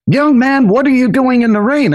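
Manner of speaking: a hint of a concerned lady calling from her car. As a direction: call out to the boy from the car window. Kind lady calls from car.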